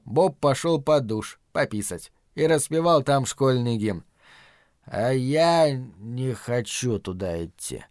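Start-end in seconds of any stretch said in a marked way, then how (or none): 4.8-7.8 put-on slow, slurred drunken mumble; drunk slurring. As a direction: slur the words like a drunk. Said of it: "заплетающимся языком пробормотал Лео".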